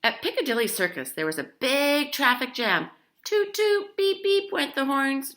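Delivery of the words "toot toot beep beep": high, loud toot-toot beep-beep; playful car horn imitation; horn honks. A narrator's reading. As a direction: honk like impatient cars.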